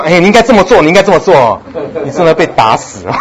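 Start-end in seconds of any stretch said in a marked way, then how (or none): 0.1-1.6 lecturing husband. Retold or imitated. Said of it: "下次不要说".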